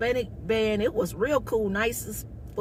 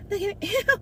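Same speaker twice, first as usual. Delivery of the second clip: laughing, high; 12 semitones higher than usual. Retold or imitated. Laughing at the man, high giggly remark.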